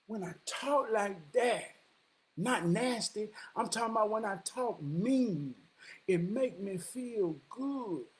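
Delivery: slow, soft retold admission; quiet confession. Woman admitting that talking mean feels good, quietly. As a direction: draw it out softly, word by word. Her confession repeated, slowly.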